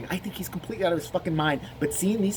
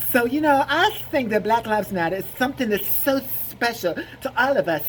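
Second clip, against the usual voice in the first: higher, exaggerated lisping camp voice; hammed-up flamboyant man; 6 semitones higher than usual. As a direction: hiss every s in a mock lisp.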